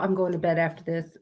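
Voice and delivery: ordinary talk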